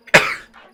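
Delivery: loud sneeze; sudden sharp sneeze; sneezing. Real, not acted. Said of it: "Sorry for the sneezing".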